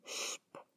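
Sp as a bare voiceless "shp"; no E before SP. Shown as a demonstration. Teacher warning learners never to add an E, voiceless "shp" for Sp. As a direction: say Sp as "shp" with no vowel in front. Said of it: "nunca se pronuncia una E".

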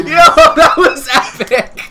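loud and high through laughter; laughing excitedly. Real, not acted. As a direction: cackle out the win. Celebrating the kill.

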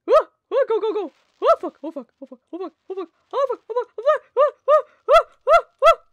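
yelling in terror while running for it; panicked screaming. Real, not acted. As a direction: scream in real panic as the fuse burns down. Dynamite about to blow, fleeing.

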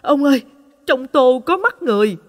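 high, loud scream calling out to her husband; terrified scream. Acted. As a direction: shriek it in pure horror. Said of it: "hét lên". (hét lên kinh hãi)

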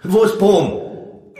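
high, loud accusation; outraged. A narrator's reading. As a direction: shout it in indignation. Angry hero confronting dragon after near miss.

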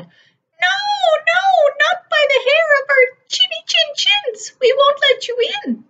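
very high, loud piglet refusal; little pig refusing the wolf, high and loud; high squeaky pig. A narrator's reading. Pigs refuse.

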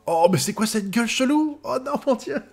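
high-pitched shocked reaction; appalled surprise. Real, not acted. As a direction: recoil in disbelief, voice way up. Découvre une tête bizarre. (stupéfaction horrifiée, voix très aiguë)

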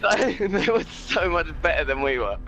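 laughing while talking, slow. Real, not acted. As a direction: chuckle through the words. Laughing after a lucky win.

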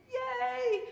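very high, loud yay; demonstrated cheer. Shown as a demonstration. Pastor recaps reaction to things we like, gleeful cheer. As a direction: cheer it out playfully. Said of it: "When we have things that we like".

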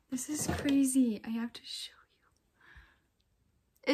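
unvoiced hushed whisper. Real, not acted. Showing the faint test to viewers, whispered. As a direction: whisper it so he won't hear.

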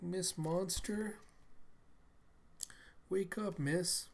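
put-on high, slow little-girl voice; timid child waking someone. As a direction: gently coax a sleeper awake. Small girl waking someone gently.

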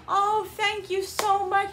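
very high, slow, gushing grateful voice; the lady thanking her sweetly; gushing thanks. Retold or imitated. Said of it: "She's like".

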